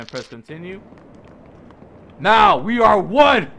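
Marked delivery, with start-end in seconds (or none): 2.2-3.5 loud, slow rallying cry; rallying declaration. Acted. Gamer rallying his mob army, loud and slow. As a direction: proclaim it slowly to the army.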